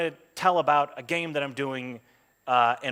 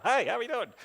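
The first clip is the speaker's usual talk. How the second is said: chirpy greeting; 10 semitones higher than usual. Acted. Contrasts friendly self with tough role.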